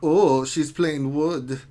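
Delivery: put-on naughty German guy accent; dirty German accent. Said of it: "it sounds so dirty when you say it in a German accent".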